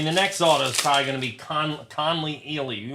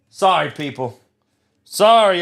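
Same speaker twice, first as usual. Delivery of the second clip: loud outburst; 6 dB louder than usual. Real, not acted. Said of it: "I've reached the top of being pissed off".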